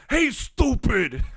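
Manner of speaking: high, loud, slow gravel-free shout of stupid; Macho Man impression. Impersonating Macho Man for his co-host, drawn-out yell. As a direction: stretch it out like the Macho Man yelling. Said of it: "and I love Macho".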